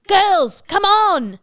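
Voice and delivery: high, loud rallying call; urgent call. Acted. John urging the girls to run, loud and high. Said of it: "John's like".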